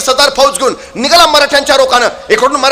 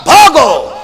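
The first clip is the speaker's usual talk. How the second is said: laughing shout to flee; 3 semitones higher, 4 dB louder, much slower than usual. Acted. Marathas reverse once more and flee, laughing shout. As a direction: cry flee while laughing at the trick.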